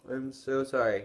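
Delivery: loud, high yawned apology; yawn-distorted words; talking through a yawn. Real, not acted. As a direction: apologize while a yawn takes over. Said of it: "I keep yawning".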